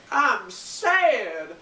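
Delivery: high, loud fake wail; hammed-up pouty whine; mock sadness. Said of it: "and she's like".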